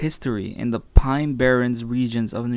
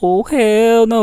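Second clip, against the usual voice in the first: loud; 8 semitones higher, 12 dB louder, slower than usual. Real, not acted. Reacting to a spooky legend.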